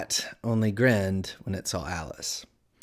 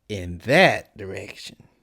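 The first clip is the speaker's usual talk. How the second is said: slow, drawn-out cat character voice; sly Cheshire Cat; 4 dB louder, slower than usual. A narrator's reading. Cat answering Alice's question, slow and teasing. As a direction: stretch the words out in lazy riddles.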